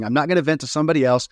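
ordinary talk, nothing marked

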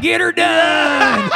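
Southern catchphrase. Retold or imitated. Imitating the comedian's stage catchphrase in a Southern drawl. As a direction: stretch it in a hammed-up Southern drawl. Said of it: "He was like".

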